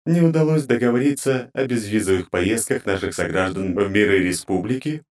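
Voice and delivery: high, grating divine voice; cold goddess interrogating her servants; inhuman goddess voice. Acted. Goddess voice continues.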